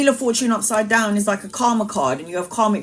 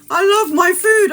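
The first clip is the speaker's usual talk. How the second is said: high, loud gushing; excited; 10 semitones higher, 5 dB louder than usual. Real, not acted. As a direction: squeal about loving food. Gushing about food.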